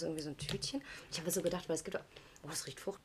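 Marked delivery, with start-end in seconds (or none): none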